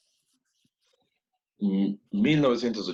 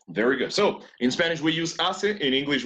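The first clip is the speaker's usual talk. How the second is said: ordinary talk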